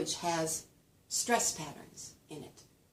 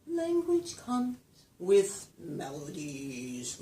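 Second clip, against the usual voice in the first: drawn-out melody; much slower than usual. Shown as a demonstration. Lecturer showing that language has melodies, drawn-out. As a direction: stretch each word into a slow glide. Same phrase sung-out, stretched, shifted.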